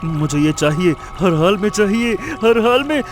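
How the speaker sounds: covetous insistence, mounting step by step; obsessive greed. Acted. Greedy man obsessing over diamonds. (लालच भरी बढ़ती ज़िद)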